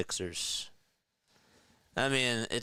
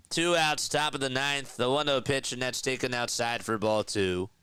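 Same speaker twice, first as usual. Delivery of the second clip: higher, energized baseball call; sportscaster lift, starting high and settling; live play-by-play; 4 semitones higher than usual. Real, not acted. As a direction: call the pitch as it happens.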